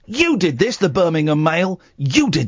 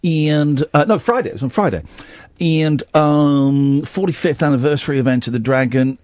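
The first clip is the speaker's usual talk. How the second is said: normal talking voice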